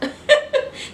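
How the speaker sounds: a sudden high, airy burst of laughter; breathless high laugh. Real, not acted. Preacher overjoyed at her own message, breathless laugh. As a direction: let a delighted laugh escape.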